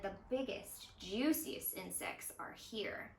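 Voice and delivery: gentle read-aloud narration with voiced dialogue; storybook reading. A narrator's reading. Zoo educator reading a story to young viewers, gentle storytelling. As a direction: read it like a picture book for kids. Reads picture book aloud.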